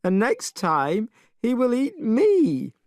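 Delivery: a touch of sobbing fear; still crying. Acted. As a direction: plead through tears, slowly. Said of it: "still crying".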